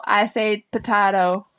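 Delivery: ordinary delivery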